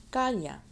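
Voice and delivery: "Calla" as CA-ya; strong "Ca" in "Calla", then falling; stress on CA. Shown as a demonstration. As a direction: lean on the first syllable of "Calla". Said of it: "but the ca is not".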